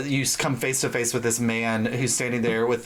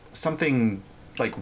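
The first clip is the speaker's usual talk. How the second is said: ordinary delivery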